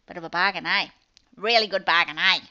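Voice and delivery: loud Kiwi lilt with a tagged-on eh; Kiwi accent. Real, not acted. Seller pitching her bargain offer, in a Kiwi accent. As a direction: punch it out in a New Zealand accent. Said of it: "I'm picking up my Kiwi accent again".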